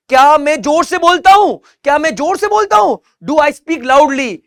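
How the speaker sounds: loud model question. Shown as a demonstration. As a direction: belt the question out for the class. Models a sentence about speaking loudly. (ज़ोर से सवाल बोलते हुए)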